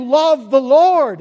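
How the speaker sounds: loud, slow "Love the Lord"; urgent exhortation. Acted. Preacher urging the congregation to love the Lord, loud and slow. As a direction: press "Love the Lord" home on the listeners. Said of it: "David is telling you".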